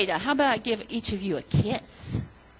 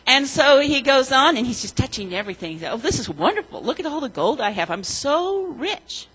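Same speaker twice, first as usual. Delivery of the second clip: put-on storytelling to children; 5 dB louder than usual. A narrator's reading. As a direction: tell it like a children's story, leaning on each gold. Children's story told in church.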